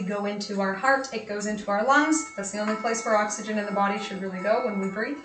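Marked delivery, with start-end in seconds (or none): none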